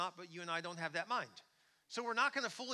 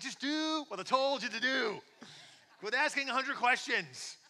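slow, loud, high scolding; exasperated shout; 8 semitones higher, 10 dB louder, slower than usual. Acted. God fed up with our questions, shouting in exasperation. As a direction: bellow it like a fed-up parent.

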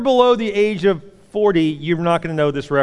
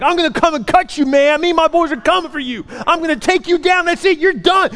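much higher, louder mock-gangster yelling; hammed-up threats screamed down the phone; screaming threats; 10 semitones higher, 5 dB louder than usual. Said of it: "I just start screaming and yelling".

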